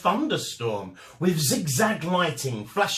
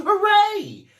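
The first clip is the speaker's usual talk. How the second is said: loud, much higher cheer; cheering shout; 7 dB louder than usual. A narrator's reading. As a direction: villagers cheering the whale off. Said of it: "villagers shouting".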